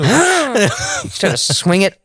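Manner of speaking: breathy gasp then a slow high plea; high, slow mock gasp. Gasping mock drama.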